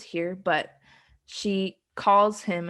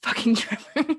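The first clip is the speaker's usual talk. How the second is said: laughing quote; 3 semitones higher than usual. Retold or imitated. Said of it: "seeing a flash of all caps".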